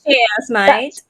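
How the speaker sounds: playful British lilt, rising at the end; mock British accent. Co-host echoing the host's farewell, mock British accent. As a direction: echo it in a put-on British accent. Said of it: "Paano ba British accent?".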